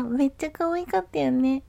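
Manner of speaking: usual speaking voice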